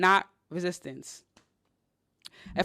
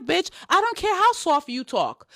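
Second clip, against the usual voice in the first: ordinary talk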